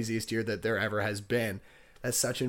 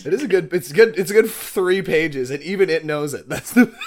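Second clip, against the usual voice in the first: laughing; 6 semitones higher, 10 dB louder than usual. Real, not acted. Cracks up over the page.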